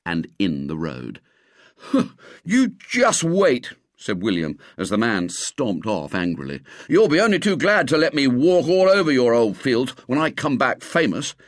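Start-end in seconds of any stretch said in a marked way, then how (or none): 1.8-3.7 high, slow, huffy threat; defiant. Acted. Boy defies departing angry farmer. 6.9-11.4 put-on raised, higher boyish indignation; defiant boast. Boy calls after departing farmer.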